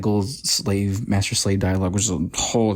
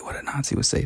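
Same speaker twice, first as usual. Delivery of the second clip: whisper; 3 semitones higher, 6 dB quieter, faster than usual. Acted. Joking reply aside.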